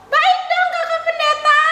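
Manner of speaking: high, cheery puppet voice; chirpy child puppet saying hello; puppet girl Tiur. Acted. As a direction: greet the kids as the little puppet.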